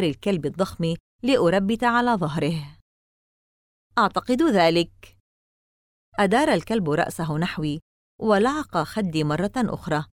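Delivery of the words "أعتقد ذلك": usual speaking voice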